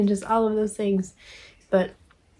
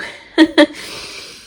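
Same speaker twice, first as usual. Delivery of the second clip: loud laugh; 7 semitones higher, 9 dB louder than usual. Real, not acted. Laugh after thanks.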